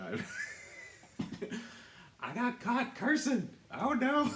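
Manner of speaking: high, loud laughing confession. Real, not acted. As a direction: laugh it out, caught red-handed. Caught cursing by S1.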